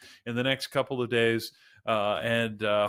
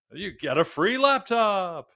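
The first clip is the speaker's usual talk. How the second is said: high, slow infomercial pitch; hard sell ad; 9 semitones higher, 4 dB louder, slower than usual. Pretend. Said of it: "the classic lines".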